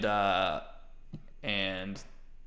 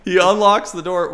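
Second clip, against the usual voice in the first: normal talking voice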